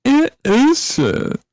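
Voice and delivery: high squeaky mock hurt voice.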